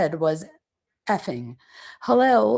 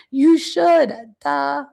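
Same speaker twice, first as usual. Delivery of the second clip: imitating her loud, excited "You should", pitched higher; 6 semitones higher, 7 dB louder, much slower than usual. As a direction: blurt it out too loud, overexcited. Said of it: "excited and speaking so loudly".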